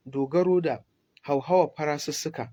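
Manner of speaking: ordinary talk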